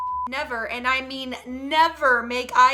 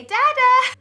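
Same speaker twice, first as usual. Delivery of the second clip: put-on high, loud baby-talk; coaxing a baby; 11 semitones higher, 10 dB louder than usual. Mother teaching baby first word, high coaxing. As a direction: pitch it way up for a baby.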